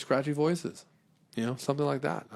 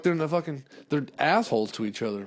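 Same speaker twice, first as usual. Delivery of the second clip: ordinary talk, nothing marked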